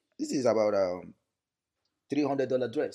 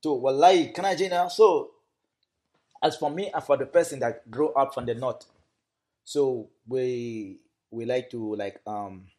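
lively Hausa-flavoured Nigerian English, raised voice; Northern Nigerian Aboki accent; 3 dB louder than usual. Acted. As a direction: greet like a cheerful Northern Nigerian man. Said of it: "so speak to us as Dawuda".

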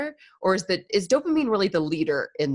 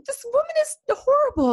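shrill-high, louder mock warning; horrified friend; 6 dB louder than usual. Friend warning the smitten man, mock horror. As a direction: squeal it like a scandalised friend. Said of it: "It's like".

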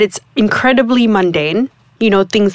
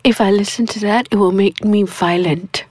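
normal talking voice

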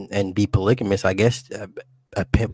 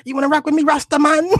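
high, loud mock Jamaican challenge; Jamaican accent; 4 dB louder than usual. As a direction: crow it in hammed-up patois. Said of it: "talking that Jamaican accent".

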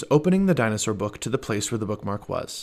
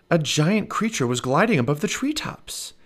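usual speaking voice

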